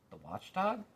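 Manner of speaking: lightly puzzled rise on watchdog; quizzical question. A narrator's reading. Milo puzzled by the watchdog, quizzical. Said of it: "said Milo quizzically".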